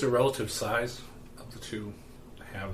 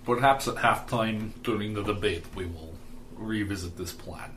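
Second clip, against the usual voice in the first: put-on Russian accent. Candidate plotting with his brother-in-law, in a Russian accent. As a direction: plot coolly in a thick Russian accent. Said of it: "I find it deeply satisfying to do the Russian accent".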